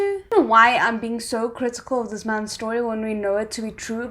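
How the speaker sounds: ordinary delivery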